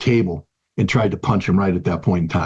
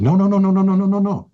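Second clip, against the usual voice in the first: emphatic repeated no; 8 semitones higher than usual. Retold or imitated. Dad firmly refusing to intervene.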